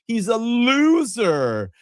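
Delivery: high, slow, drawn-out Valley Girl mockery; mocking a rival in a Valley Girl voice. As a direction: stretch every word like a snooty Valley Girl. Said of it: "Sounds like a Valley Girl".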